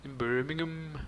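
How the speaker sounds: high, soft mock Brummie; Birmingham accent. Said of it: "please do the accent".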